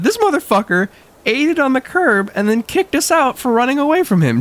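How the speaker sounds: high incredulous outburst; high indignant realization; mock outrage. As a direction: exclaim it in disbelief.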